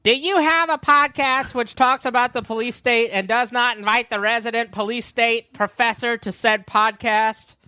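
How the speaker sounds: high, loud indignant friend voice; indignant Maya; Maya impression. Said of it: "weak Maya impression".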